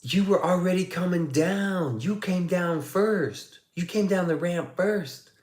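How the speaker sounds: high-pitched imitation of a protesting kid; flabbergasted kid. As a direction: mimic the stunned kid's drawn-out protest. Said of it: "he was flabbergasted".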